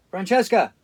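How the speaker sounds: shouting a name up high and loud; calling out. Pretend. Man jokingly calls his imaginary French maid, loud and high. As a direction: call out loud across the house.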